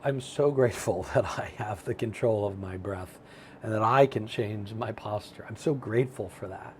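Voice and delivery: grateful demo. Shown as a demonstration. Coach showing the gratitude step, softly sincere. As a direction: say it gently, meaning it. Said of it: "offer gratitude".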